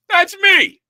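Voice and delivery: excited reveal. Real, not acted. Full disclosure laugh-reveal.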